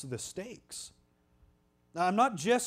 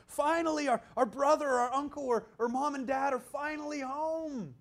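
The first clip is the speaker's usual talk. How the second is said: higher, eager voice of a family reunion; excited welcome; 8 semitones higher than usual. Retold or imitated. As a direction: greet family pulling into the drive. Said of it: "when we're so excited".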